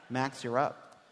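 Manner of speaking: ordinary delivery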